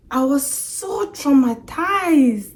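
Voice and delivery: "so traumatized" high, loud and drawn out; dramatic emphasis. Real, not acted. Telling viewers about the accident, dramatic stress on traumatized.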